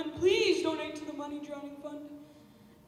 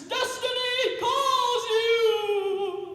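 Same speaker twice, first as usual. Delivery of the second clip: slow dramatic singsong; 4 semitones higher, 3 dB louder, much slower than usual. Acted. Dramatic proclamation.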